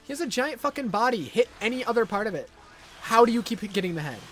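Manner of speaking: loud, exasperated yelling at the game; frustrated yelling. Real, not acted. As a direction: shout at the screen in disbelief.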